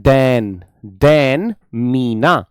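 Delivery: drawn-out dictation of the word pair; slow dictation. Shown as a demonstration. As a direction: spell it out slowly for the class. Teacher dictating the answer.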